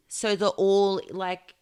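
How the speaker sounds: ordinary delivery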